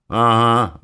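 loud, high bitter mock agreement; mock him with bitterness; bitter mimicking. A narrator's reading. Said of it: "с горечью передразнил его я". (передразнивая, с горечью)

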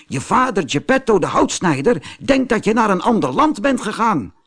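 put-on high bird voice, hurrying the boy along; a dove urging him to come now; urgent dove. A narrator's reading. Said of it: "riep de duif".

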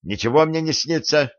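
boy's stung outcry, mildly voiced; crying out in protest. A narrator's reading. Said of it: "выкрикнул отрок".

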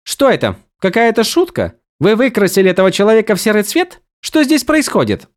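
high, rushed, incredulous questions; bewildered outburst. A narrator's reading. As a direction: fire off confused demands. Vivenna bewildered, demands answers. (растерянные возмущённые вопросы)

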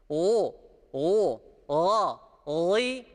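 deep guttural gh sound; ghayn drill. Shown as a demonstration. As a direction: gargle the ghayn from the throat. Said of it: "gayn harfi gibi okuyacağız". (gayn harfini gırtlaktan gargara gibi çıkar)

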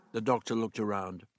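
ordinary delivery, nothing marked

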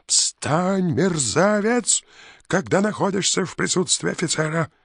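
high, loud bellowing officer; roaring yell. A narrator's reading. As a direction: bellow it at full throat. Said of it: "заорал во всю глотку".

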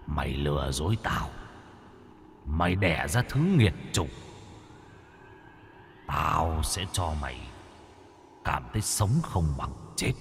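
low, soft menacing villain voice; menacing threat. A narrator's reading. Villain threatening the deceiving mother, low and menacing.

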